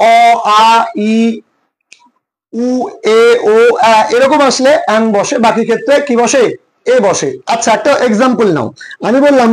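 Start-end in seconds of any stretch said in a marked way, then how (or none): 0.1-3.9 "অ, আ, ই, উ, এ, ও, আ" as separate pure vowels; "অ, আ, ই, উ, এ, ও, আ" recited as isolated vowel sounds. Shown as a demonstration. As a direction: sound out "অ, আ, ই, উ, এ, ও, আ" one at a time with gaps. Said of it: "vowel সাউন্ডের উচ্চারণ যেমন".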